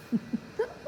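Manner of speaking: breathy airless giggle for a grin; demo creepy grin laugh. Shown as a demonstration. Teacher showing the kids Mortimer's smile with a giggle. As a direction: show the awkward smile with a little laugh. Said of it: "Like this".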